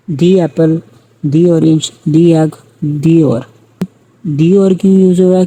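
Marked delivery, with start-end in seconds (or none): 0.1-3.4 each دی said "dee" before the noun; long "ee" vowel in every دی. Shown as a demonstration. Teacher giving vowel examples, each دی said as "dee". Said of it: "دی کا sound use کریں گے". 4.2-4.7 دی said "dee" before اور; long "ee" in دی, running into اور. Shown as a demonstration. Teacher asking why it is used, دی said as "dee". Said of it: "یہاں پہ ووول کا sound ہے".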